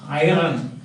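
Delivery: "iron" with its R pronounced; rhotic R. Retold or imitated. Teacher imitating Germans who pronounce R everywhere, rhotic R. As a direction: say "iron" as eye-run. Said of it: "Germans pronounce R everywhere".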